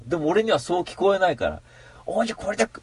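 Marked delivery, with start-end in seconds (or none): 2.0-2.7 a hint of the high cat voice; lightly voiced cat. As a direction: start the cat line a bit higher. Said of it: "猫ですよ".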